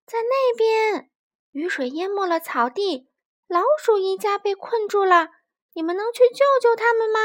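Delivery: high, loud call across water; fox calling out. A narrator's reading. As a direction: call for help from the riverbank. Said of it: "狐狸喊道".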